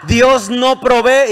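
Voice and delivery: full-force preaching cry; preacher's shout. Acted. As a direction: shout it at the congregation, then stretch "Dios no provee". Preacher climaxes at the congregation.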